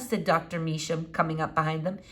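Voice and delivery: ordinary delivery, nothing marked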